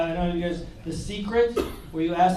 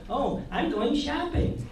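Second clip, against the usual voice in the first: put-on higher, slow girly voice; girl posting online; 7 semitones higher, slower than usual. Some girl announcing her plans on Twitter, higher mock-girl voice. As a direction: lightly chirp an idle update. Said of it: "'Cause some girl's like".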